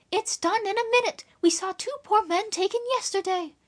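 put-on trembling girl. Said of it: "Her voice trembled".

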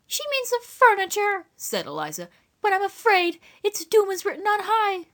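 high rustic farmwife voice; country woman. Acted. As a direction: lament like a gloomy farmwife. Eliza voiced.

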